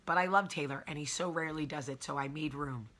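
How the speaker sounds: usual speaking voice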